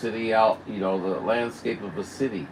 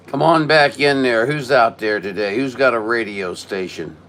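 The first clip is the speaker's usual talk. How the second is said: loud radio call, much louder; 2 semitones higher, 7 dB louder than usual. Real, not acted. Operator on radio calling for any station, loud call. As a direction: project out over the air to find anyone.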